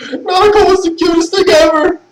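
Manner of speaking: high-pitched gushing about a cute character; giddy high voice gushing over Naruto; high excited gush. Real, not acted. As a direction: squeal it like an overjoyed fan.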